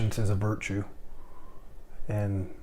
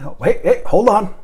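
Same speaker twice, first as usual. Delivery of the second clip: higher, louder playful hey, hold on; mock excited interruption; 10 semitones higher, 8 dB louder than usual. Stopping the trainee to film his praise, mock excited. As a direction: jump in like you must record it.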